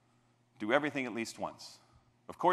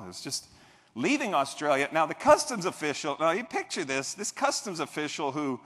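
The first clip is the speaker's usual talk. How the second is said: ordinary talk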